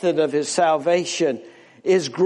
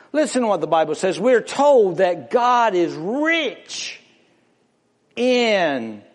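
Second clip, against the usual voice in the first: usual speaking voice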